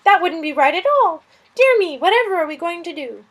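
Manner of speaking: high, loud, fretful lizard voice; a flustered little lizard fretting over a guest; fussy lizard. Acted. Lizard Suz fussing about the stranger, high and loud.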